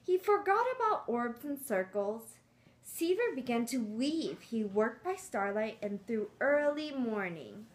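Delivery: lively storybook read-aloud; reading to children. Acted. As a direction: read it like a picture book to kids.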